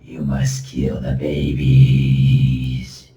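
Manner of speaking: low, slow whispering demon; slow low demonic command. Pretend. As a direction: hiss it like a tempting demon. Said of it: "he starts whispering to you".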